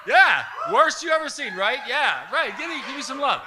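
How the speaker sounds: high, shouted victory boasts; loud, cocky acted-out celebration; triumphant gloating. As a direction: work the crowd like a reigning king. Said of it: "I was like the king of Scranton".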